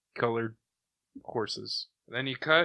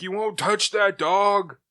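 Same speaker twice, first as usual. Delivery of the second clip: high, loud acted warning; hammed-up defiant yell; defiant hero shout; 9 semitones higher, 6 dB louder than usual. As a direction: shout it in mock-heroic protectiveness. Acted hero defending his dog.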